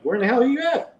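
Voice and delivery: high-pitched incredulous question; retold bewildered demand. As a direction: reenact disbelief on a phone call. Retold shock at friend's call.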